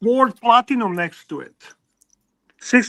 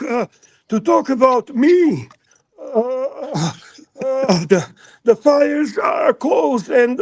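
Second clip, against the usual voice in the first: higher-pitched, jittery stressed character with a put-on Serbian accent, voice cracking into yelps; Mike the Snake voice; 4 semitones higher than usual. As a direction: play a frazzled, panicky guy blurting out his troubles in a hammed-up Serbian accent. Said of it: "You've got to read this as Mike the Snake".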